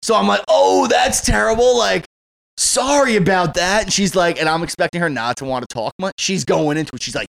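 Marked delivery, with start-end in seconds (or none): 0.5-3.8 mock sympathy. To the neighbor about her cheating husband, hammed-up pity. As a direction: lay the sympathy on thick.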